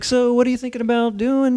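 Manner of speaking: high, easygoing dude voice; laid-back contractor. Retold or imitated. Contractor voiced to the host, loose and easy. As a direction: play the chill contractor.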